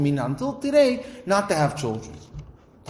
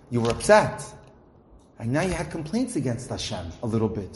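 usual speaking voice

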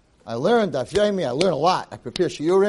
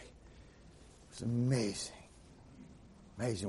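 dreamy hushed gush; 6 semitones lower, 18 dB quieter, slower than usual. Retold or imitated. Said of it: "They're like".

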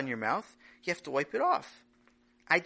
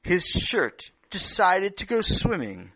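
storytelling narration; 8 dB louder than usual. A narrator's reading.

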